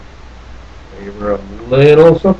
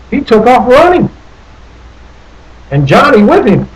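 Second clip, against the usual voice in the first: excited chase; 8 semitones higher than usual. A narrator's reading. Narrator telling children of the bear's wild run, excited. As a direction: build the rush as the bear bolts. Bear drags Johnny through woods.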